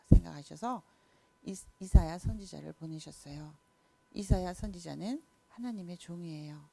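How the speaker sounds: ordinary talk, nothing marked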